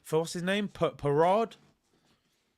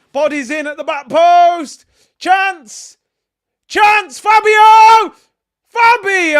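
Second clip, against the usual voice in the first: excited shout; 11 semitones higher, 18 dB louder, slower than usual. Real, not acted. Live chance in the match.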